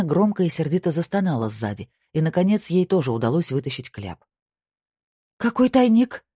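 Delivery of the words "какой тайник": high, louder, startled female voice; gasped question. A narrator's reading. Wife hears of a hiding place, gasping in surprise. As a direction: blurt it out in shock. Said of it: "ахнула она".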